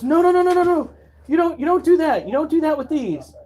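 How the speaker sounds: high, urgent big-brother voice; a shrill older brother telling him off; brother warning. Retold or imitated.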